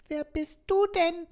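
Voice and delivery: very high doll voice, amazed; a little talking doll, surprised; Bonnie, astonished. Acted. Doll meeting the robot, astonished in a very high voice. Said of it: "fragte sie mich erstaunt".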